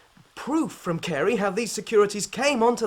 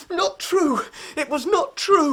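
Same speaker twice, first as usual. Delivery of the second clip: crying out high and loud, slowing on the second line; tearful denial; 8 semitones higher, 6 dB louder, slower than usual. Acted. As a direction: break down insisting it was not true. Son defends his accused father.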